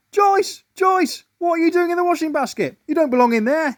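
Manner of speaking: high, loud calls to Joyce; puzzled husband. A narrator's reading. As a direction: call out to the wife in the basket. Husband calling wife.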